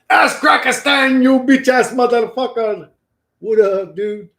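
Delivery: playful shout. Real, not acted. Streamer yelling at a chatter over live chat, loud. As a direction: yell it at the chatter.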